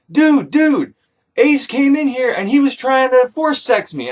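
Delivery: high, whiny complaint; tattling like a wronged kid; upset Giton. Retold or imitated. Giton complains to his lover about Ace, whiny.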